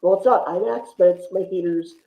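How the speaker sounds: ordinary talk, nothing marked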